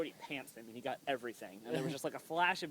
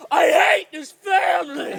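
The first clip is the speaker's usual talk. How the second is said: mock angry shout; 7 semitones higher, 10 dB louder than usual. Brother storms out, slams door.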